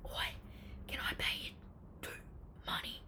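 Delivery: hushed, furtive offer; acting out a nervous, whispered deal. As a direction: whisper it like a secret bribe. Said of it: "obviously really nervous".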